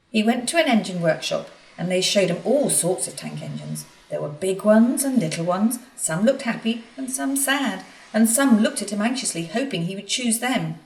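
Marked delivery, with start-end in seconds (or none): none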